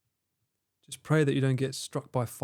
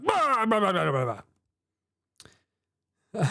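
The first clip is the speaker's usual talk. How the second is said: booming dictator voice; 6 semitones higher than usual. Retold or imitated. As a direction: parrot a bossy loudmouth. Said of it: "Chris has that booming dictator voice".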